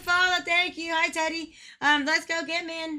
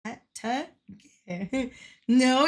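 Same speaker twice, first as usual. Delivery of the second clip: ordinary delivery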